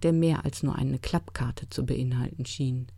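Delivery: plain reading voice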